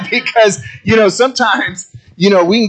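words through a laugh. Real, not acted. Pastor still laughing after the gym joke, words through a laugh. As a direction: keep laughing as you talk.

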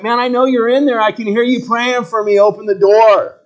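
high, loud call through a door; Peter calling through the door. Pretend. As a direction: shout to be let in, exasperated. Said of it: "He's like".

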